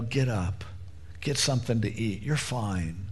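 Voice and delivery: put-on Jesus raising the little girl, gently; tender assurance. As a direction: calmly tell a child she's fine. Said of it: "tell her".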